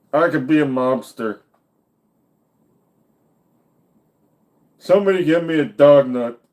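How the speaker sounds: loud put-on fat-man voice; mock fat man. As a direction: play a big mobster with no neck.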